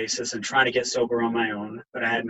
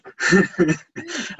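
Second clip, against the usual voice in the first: a real, choked laugh through emotion; tearful laugh; 8 semitones higher, 3 dB louder than usual. Real, not acted. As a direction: laugh to steady yourself, pitched up. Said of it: "Give me a moment to compose myself".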